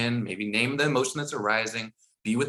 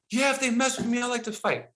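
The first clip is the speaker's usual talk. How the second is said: put-on higher kid voice, a touch defiant; 12 semitones higher than usual. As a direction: answer like a stubborn fifth grader. Boy's answers voiced.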